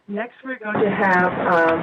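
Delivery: mic echoing through the hall. Real, not acted. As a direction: voice booming with room echo. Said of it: "I'm echoing".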